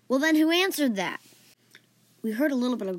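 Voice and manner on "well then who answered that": smug comeback, higher in pitch. Acted. Friend answers the voice behind the door, smug. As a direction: toss it back smugly, a bit louder. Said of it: "Archie then said back smugly".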